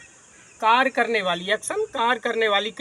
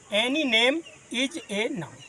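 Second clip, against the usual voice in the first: very slow, word by word; slow dictation; much slower than usual. Shown as a demonstration. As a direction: let the students write each word. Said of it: "teacher dictating definition". (धीमे-धीमे लिखवाना)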